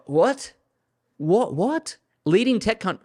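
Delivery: can't believe what you just read, pitch climbing each time; incredulous what. Real, not acted. Annoyed viewer reacts to the Prime Minister's tech boast, high incredulous.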